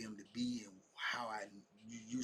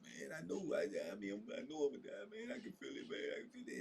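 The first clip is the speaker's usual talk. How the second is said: imitating his pained friend, high breaking pleas; high, strained imitation of despair; pained resignation; 5 semitones higher than usual. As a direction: retell it as a man in anguish facing death. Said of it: "the pain in his voice".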